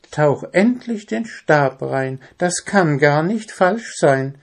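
high, impatient bird voice; urging on. A narrator's reading. Zwitschi cheers the hesitant dwarf on, urging. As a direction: egg him on, pitched high. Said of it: "feuerte ihn Zwitschi an".

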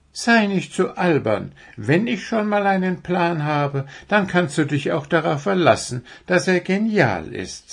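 high, self-assured deer voice; proud. A narrator's reading. Deer boasts of its plan to the owl, proudly. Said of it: "sagte das Reh stolz".

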